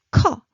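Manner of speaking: 커 with a strong aspirated puff of air, loud; burst of air. Shown as a demonstration. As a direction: blast out 커 with a puff of breath. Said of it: "burst of air".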